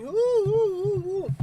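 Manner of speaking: high, loud, drawn-out oohs; delighted at a new subscriber; slow thrilled ooh ooh ooh; excited slow oohs. Real, not acted.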